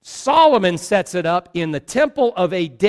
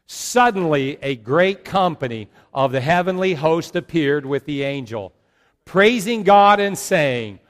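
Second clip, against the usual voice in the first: scripture read aloud together; 2 semitones lower, 4 dB louder than usual. Acted. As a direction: read the passage out with the whole congregation. Congregation reads Luke aloud together.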